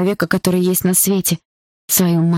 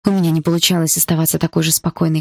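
plain reading voice

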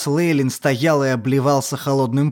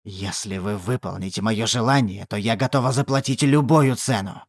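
low pleading witch; 7 semitones lower, 4 dB quieter than usual. Acted. Witch begs demon.

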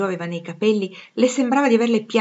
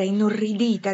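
ordinary delivery

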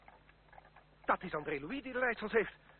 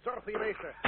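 normal talking voice

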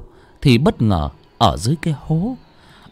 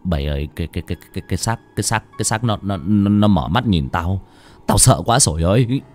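stuttering, repeating the first words; frightened stammer; 3 semitones lower, faster than usual. Acted. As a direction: stammer in fear, barely getting it out. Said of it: "miệng lắp bắp". (lắp bắp vì sợ hãi)

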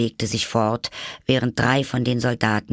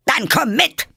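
high, loud bellow; roared command; 6 dB louder than usual. A narrator's reading. Queen summoning Alice to the game, roaring. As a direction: roar it as an order. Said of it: "brüllte die Königin".

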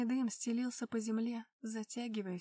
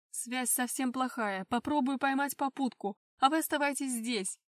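commanding; 7 dB louder than usual. Acted. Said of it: "приказал Макар".

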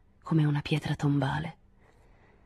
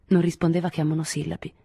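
usual speaking voice

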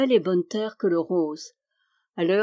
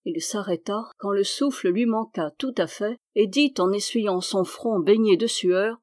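usual speaking voice

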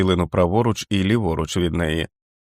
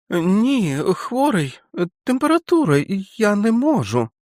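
put-on whimpering child; 13 semitones higher than usual. Feverish boy resisting.

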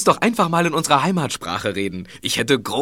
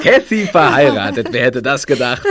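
louder, chuckling disbelief; laughing amazement; 2 semitones higher, 5 dB louder than usual. Acted. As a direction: laugh through the news. Laughing at the news.

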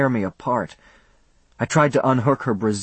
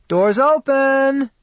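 high, loud call through the door; shouted; 6 dB louder, much slower than usual. A narrator's reading. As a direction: call out loudly from across the room. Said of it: "she shouted".

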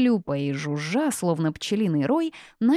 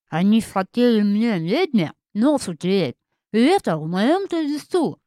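loud, high talk through chewing; indignant words round a bite of apple; mouth full; 3 semitones higher, 6 dB louder than usual. A narrator's reading. Witch explains herself to the forest spirit, mouth full. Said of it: "И, проживав".

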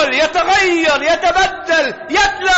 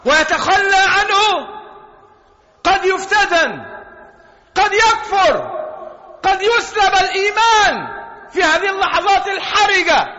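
raised, urgent sermon voice; fervent preacher; preaching at full force; 5 semitones higher than usual. Acted. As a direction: press the danger of the last moments. Preacher rising on losing faith.

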